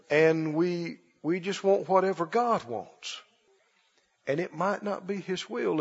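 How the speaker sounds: hushed, low mock-pastoral counsel; preacher imitation. Pastor answering a sick man who asks for healing, in hushed mock-pious resignation. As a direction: sink into a soft, halting preacher's murmur. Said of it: "Who taught them to talk like that?".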